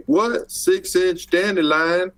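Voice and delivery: put-on terrible Texas accent. Co-host boasting as a Texan about dandelion size, in a bad Texas accent. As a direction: put on a hammy Texas drawl, slowly. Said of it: "I'm doing a terrible accent".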